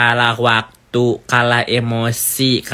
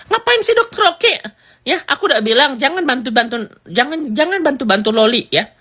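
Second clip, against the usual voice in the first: much higher, scolding mock-angry woman; Nikita angry; 10 semitones higher than usual. Nikita scolds Dokter Oki for helping Loli, high mock anger. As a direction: squeal out an angry mother's complaint. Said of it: "Nikita marah sama Dokter Oki".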